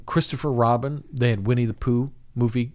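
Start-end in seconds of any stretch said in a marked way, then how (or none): none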